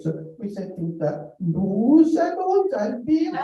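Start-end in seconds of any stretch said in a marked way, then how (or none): 1.4-3.4 put-on high, slow French with a Welsh accent; Welsh-accented schoolboy French, drawn out high. Pupils parroting their Welsh French teacher, Welsh accent. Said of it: "we learned French with a Welsh accent".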